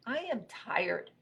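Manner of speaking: slow, high-pitched tired statement; weary robot. Acted. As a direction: drag the words out wearily. Robot twitches, worn out.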